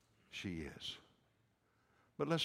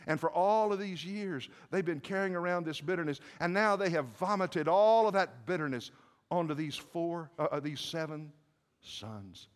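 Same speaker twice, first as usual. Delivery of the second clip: raised, high preaching voice; forceful preaching; 7 semitones higher, 6 dB louder than usual. Acted. Preacher telling congregation of years of bitterness, forceful preaching. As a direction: press the charge home loudly.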